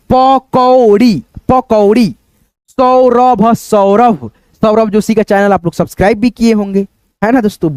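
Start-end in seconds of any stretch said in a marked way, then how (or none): 0.1-1.2 पकौड़ी slowly split as प, कौ, ड़ी; drawn-out teaching version of pakodi; syllable-by-syllable reading. Shown as a demonstration. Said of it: "औ की ध्वनि निकालेंगे". 2.7-3.5 सौरभ split as सौ, र, भ with each vowel sounded; careful teaching version of Saurabh; syllable-by-syllable reading. Shown as a demonstration. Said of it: "औ की ध्वनि निकालेंगे".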